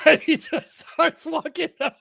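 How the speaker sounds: high voice through real laughter; laughing. Real, not acted. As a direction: laugh the words out. Laughing while telling story.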